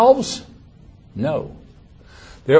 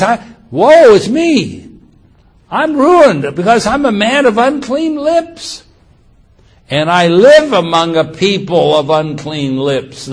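anguished cry; 4 semitones higher, 6 dB louder than usual. Acted. Preacher voicing Isaiah before God, a loud anguished lament. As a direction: cry out woe with full force. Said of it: "So he says".

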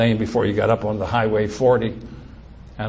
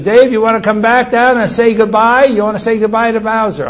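raised, high calling voice; 9 semitones higher, 7 dB louder than usual. Retold or imitated. Dad calling his son down to say goodbye, loud calling. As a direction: call up to someone on another floor.

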